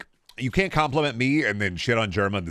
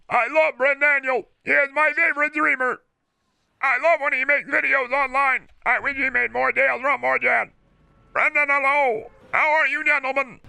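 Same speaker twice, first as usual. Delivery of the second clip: high robotic monotone, much higher and louder; old AI voice synth; 4 dB louder than usual. Retold or imitated. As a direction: read it like a cheap text-to-speech voice. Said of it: "like an old A I voice synthesizer".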